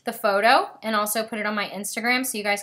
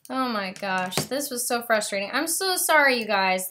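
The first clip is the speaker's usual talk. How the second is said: ordinary talk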